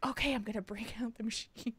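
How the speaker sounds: quick unvoiced whisper, mimicking the masseuse; whispered imitation. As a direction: hush it fast like a conspiring therapist. Masseuse quoted in hushed voice.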